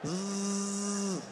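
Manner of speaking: imitated phone buzz; steady, drawn-out buzzing; phone vibration.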